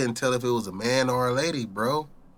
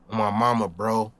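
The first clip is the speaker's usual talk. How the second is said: ordinary talk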